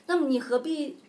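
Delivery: put-on a doomed boy begs to end waiting; hammed-up anguish; anguished prince. Prince complains to servant.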